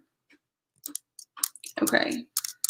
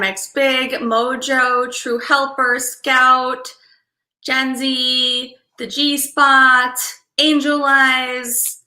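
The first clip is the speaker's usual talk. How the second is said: drawn-out names; much slower than usual. Real, not acted. Calling out viewer names slowly.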